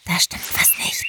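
tense hissed whisper. Acted. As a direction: hiss it under your breath. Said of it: "zischte sie zu Chris".

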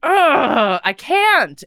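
high, loud, exasperated; frustrated groan. Retold or imitated. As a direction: give up in a huff. Frustration while meditating.

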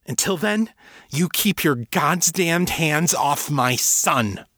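high, slow, furious warning; fierce protective mother; angry warning. A narrator's reading. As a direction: warn him off, dragging out each word. Mother warns father off her son.

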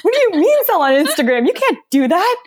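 high, pressed mock panic; anxious disbelief. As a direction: play your own anxious outburst. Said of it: "you can even hear in my voice".

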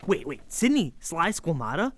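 half-whispered double take; can't believe the name you just heard; stunned disbelief. Acted. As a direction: kid meeting his comedy hero, stunned. Meeting a comedy legend.

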